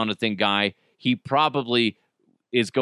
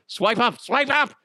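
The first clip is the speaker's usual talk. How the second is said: panicked urging; 10 semitones higher than usual. Retold or imitated. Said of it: "And I swipe".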